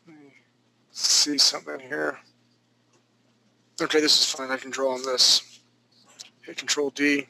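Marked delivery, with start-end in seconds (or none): none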